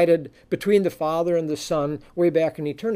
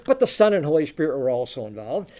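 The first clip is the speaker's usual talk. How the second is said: usual speaking voice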